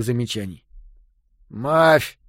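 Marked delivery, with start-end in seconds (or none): 1.5-2.2 lipless mouth voice. A narrator's reading. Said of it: "сквозь каплевидное отверстие, которое когда-то было его ртом".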